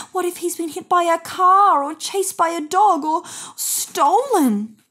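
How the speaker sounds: spiral into fearful what-ifs; a worried owner imagining the worst; anxious worry. A narrator's reading. Win's terrible thoughts.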